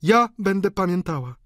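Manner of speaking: high, loud female voice; resolute countess. A narrator's reading. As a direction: insist with feeling. Countess answers reconciling sister.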